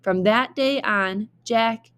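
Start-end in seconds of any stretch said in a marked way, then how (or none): none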